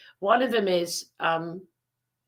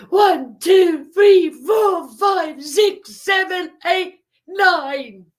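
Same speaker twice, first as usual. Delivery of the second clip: much higher, much louder counting; shouted count; 11 semitones higher, 10 dB louder, much slower than usual. Retold or imitated. Boy counting for the stranger, shouting each number.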